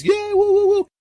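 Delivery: put-on very high kid whoops; cheering kid. The boy cheers his hero, very high whoops. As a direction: whoop like an excited little boy.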